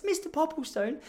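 high-pitched mock child; upset kid. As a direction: play an upset little boy talking to his teacher. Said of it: "one kid got so upset".

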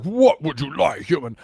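put-on Klingon voice; Klingon fairgoer. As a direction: imitate an alien warrior addressing a human. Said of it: "the guys walk over like".

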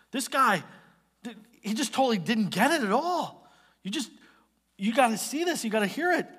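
high-pitched, urgent report; distressed tattling. Acted. Fellow servants telling the master what happened, distressed report.

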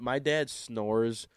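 ordinary talk

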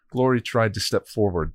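ordinary delivery, nothing marked

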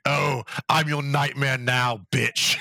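slow, gloating villain taunt; stretched-out supervillain impression; Joker impersonation. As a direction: draw it out like a cartoon villain. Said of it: "really solid Joker impersonation".

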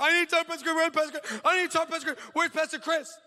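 very high, wailing imitation; mock hysterical crying. Crying woman outside demands the pastor, mock hysterical. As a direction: mimic a woman crying out frantically. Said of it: "She is crying".

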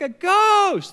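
high, loud mock-terrified shriek; mock scream. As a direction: shriek it like a panicked schoolgirl. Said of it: "they start screaming like schoolgirls".

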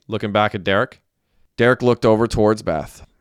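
plain reading voice

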